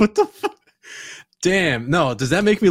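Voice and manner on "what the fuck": high, startled retelling of a shock; shocked reaction. Retold or imitated. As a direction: toss it up high like a stunned double take. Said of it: "I was like".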